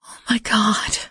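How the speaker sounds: breathless horrified whisper. A narrator's reading. Narrator whispering at the crash scene. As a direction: breathe it out in shock. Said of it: "I whispered".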